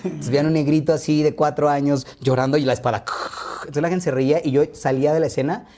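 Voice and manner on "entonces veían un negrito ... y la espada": ordinary delivery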